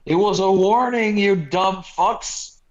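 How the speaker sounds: loud, high mock rebuke, slowing on the insult; mock scolding. Scolding the readers who missed the warning, mock exasperated. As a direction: talk down to them with exaggerated outrage.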